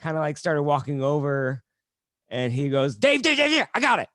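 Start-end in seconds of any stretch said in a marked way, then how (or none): 3.0-4.1 high, rapid-fire repeated name, imitated; higher-pitched insistent interjection; urgent waving-off. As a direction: imitate someone hurriedly heading off a helper. Comic waving off the club owner.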